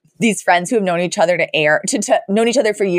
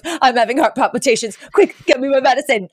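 mock panic; 5 semitones higher than usual. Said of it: "screams, and sits down and goes".

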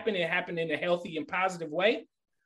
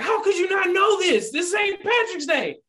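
much higher, shouting in disbelief; incredulous shout; 5 dB louder than usual. Retold or imitated. Coworker scolding him at lunch: high loud disbelieving shout. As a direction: yell it like he can't believe it. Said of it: "He's like".

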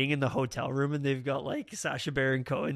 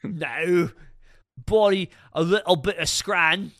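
loud, higher mock British accent; 4 semitones higher, 7 dB louder, slower than usual. Answering whether the parrots sound British, in mock British accent. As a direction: put on a cheeky British accent. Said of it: "do they have British accents?".

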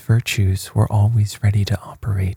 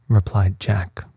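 plain reading voice

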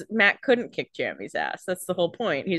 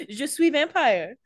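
mock French; 6 semitones higher than usual. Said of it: "Paris vampire joke".

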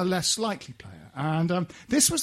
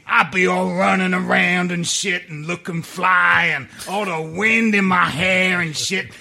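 loud, high, hammed-up street accent; impersonation of a footballer; 4 semitones higher, 9 dB louder than usual. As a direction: shout it like a brash striker. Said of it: "that wonderful impersonation you did".

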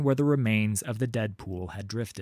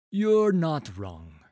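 slow; 5 semitones higher, much slower than usual. A narrator's reading. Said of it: "with a slow nod".

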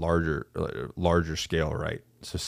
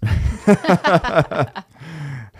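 loud burst of laughter; 11 semitones higher, 8 dB louder than usual. Real, not acted. Laughs at Biden joke.

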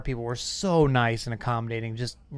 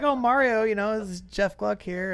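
higher-pitched imitation of a woman making introductions; introducing a reporter; 9 semitones higher than usual. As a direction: retell her introduction in a lifted, higher voice. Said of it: "she's like".

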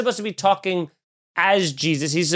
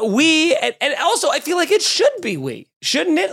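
excited outburst; 11 semitones higher than usual. Real, not acted. Arguing with Nicole, high amused outburst. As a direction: suddenly shoot up in amused disbelief. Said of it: "excited outburst".

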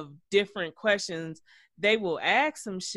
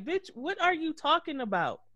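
mock incredulous; 8 semitones higher than usual. Acts out Ballistic's exasperated retort.

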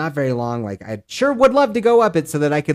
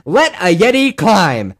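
louder, slow chant; rallying cry; 6 semitones higher, 7 dB louder, slower than usual. Real, not acted. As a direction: proclaim it like a battle cry. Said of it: "repeated, bigger second take".

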